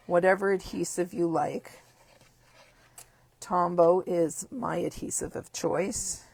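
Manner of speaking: ordinary talk, nothing marked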